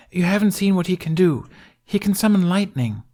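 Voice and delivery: put-on high, loud boyish insistence; a kid bragging about his brother; eager insistence. A narrator's reading. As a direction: convince the doubters with pride. Jamie defends his brother.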